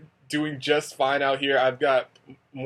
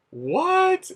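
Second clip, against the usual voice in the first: high, loud astonished what; squeaky kid's shocked what; 8 dB louder than usual. Retold or imitated. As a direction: mimic a child's sudden disbelief. Little girl's shocked reaction.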